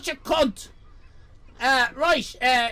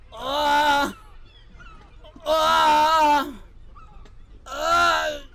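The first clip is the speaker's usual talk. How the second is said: strained groans; much slower than usual. Real, not acted. Streamer trying to get out of pose, strained groans. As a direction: groan harder with each effort.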